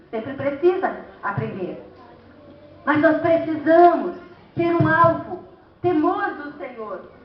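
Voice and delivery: ordinary talk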